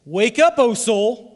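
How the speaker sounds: loud rallying cry; preacher's loud, urgent summons; rousing call. Shown as a demonstration. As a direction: call the congregation to wake up. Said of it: "we must say this".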